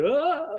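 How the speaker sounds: high pitched mock yelp; grabbed by the neck, high yelp; startled yelp. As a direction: yelp as someone grabs your neck.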